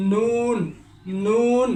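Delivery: নুন with the tongue tip on the palate, clearly nasal; drawn-out নুন as a recitation model; tajweed letter demo. Shown as a demonstration. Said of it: "নুন, এভাবে উচ্চারণ করতে হবে". (নুন স্পষ্ট করে উচ্চারণ)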